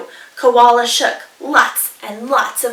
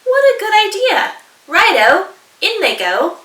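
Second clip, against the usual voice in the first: much higher, louder and quick, eager; excited dingo; 9 semitones higher, 6 dB louder than usual. Acted. Dingo eagerly agreeing to add mud, high and loud. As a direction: jump at the idea gleefully.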